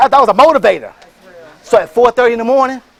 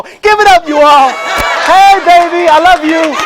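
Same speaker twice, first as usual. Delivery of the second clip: loud, higher, joyful call; loud shout-out; 6 semitones higher, 6 dB louder than usual. Real, not acted. To his wife through the camera, a loud joyful shout-out.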